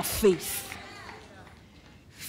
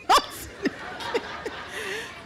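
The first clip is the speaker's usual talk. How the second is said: laugh; 8 semitones higher, 6 dB quieter than usual. Real, not acted. Laughs during retold argument.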